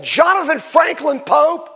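high, loud, drawn-out full-name summons; slow, commanding call of a full name; stern mother calling. Retold or imitated. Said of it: "my mother and my fifth grade teacher said".

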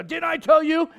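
high, loud mock parent scolding a child. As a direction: play the fed-up parent catching the kid out. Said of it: "come back and go".

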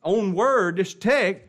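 high, loud pulpit preaching; full-force preaching. Acted. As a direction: preach it out to the congregation.

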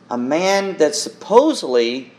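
ordinary talk

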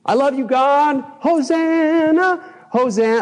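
high, slow, hammed-up praise voice; mock gushing worship. Mocking his fickle past worship.

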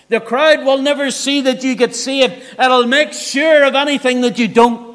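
preacher pressing the congregation to decide; fiery preaching. Acted. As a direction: drive it home from the pulpit. Preaching at full force.

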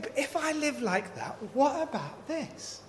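high, worried voice of the doubter with a little laugh; fretful inner voice. Retold or imitated. Preacher voicing a believer's worried doubt, high and fretful. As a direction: voice the nagging worry, lifted and uneasy. Imagined anxious believer's thought.

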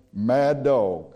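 ordinary talk, nothing marked

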